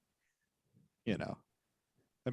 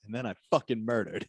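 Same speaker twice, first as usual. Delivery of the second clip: ordinary delivery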